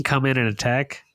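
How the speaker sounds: usual speaking voice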